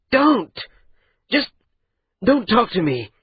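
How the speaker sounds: sharp high outburst; angry cutoff. Acted. Maati shouting the andat down, sharp and high. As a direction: stop him cold.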